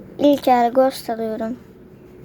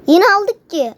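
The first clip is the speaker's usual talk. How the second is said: higher, louder happy cheer; excited; 7 semitones higher, 6 dB louder, slower than usual. Real, not acted. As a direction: cheer the win out loud. Cheering the round win.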